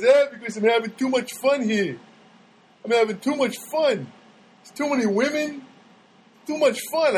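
imitating a friend's New York accent; lightly put-on New Yorker accent. Friend explaining why he would move, New York accent. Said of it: "why do you have a New York accent?".

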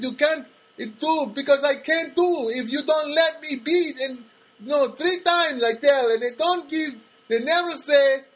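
put-on high, slow, agitated Spanish-accented complaint; exaggerated Spanish-accented worker with a grievance; imitated Spanish accent. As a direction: grumble in halting, broken English with a Spanish accent. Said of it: "his English is as good as".

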